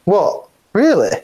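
high, rising mock disbelief; surprised disbelief. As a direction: act stunned by the offer. Taken in by the joke.

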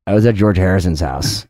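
low-pitched boastful confession; mock confiding brag. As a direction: drop your voice right down to drop a name. Boasting as he would tell it.

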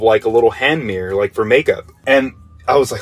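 normal talking voice

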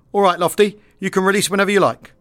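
lightly acted skipper giving clearance; calling over intercom. Skipper calls pilot over intercom.

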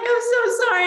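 high, loud laughing apology; laughing through tears. Retold or imitated. Mother apologizing to judge mid-testimony, laughing through tears. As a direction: apologize while laughing and crying. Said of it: "bawling the whole time".

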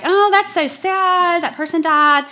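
high, slow mock-childish lament; childlike sad voice. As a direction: play your younger self, naively sad. Child self quoted to mom.

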